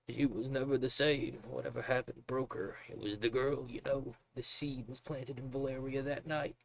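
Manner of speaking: a broken father rambling in grief; distraught father. Acted. The drunk father raving about his lost daughter, strained and high.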